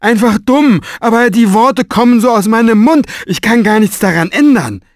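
high, loud, blustering apology; flustered strong man. A narrator's reading. Strong man apologizing to Mo, high and loud. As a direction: babble it out, embarrassed and hungover.

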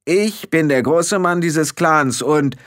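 slow, high-pitched boast; emphatic angry proclamation; blustering. A narrator's reading. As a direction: assert rank indignantly until cut off. Rob blusters, then is interrupted.

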